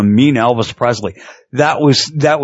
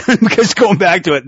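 ordinary delivery

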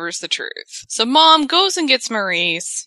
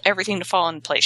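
plain reading voice

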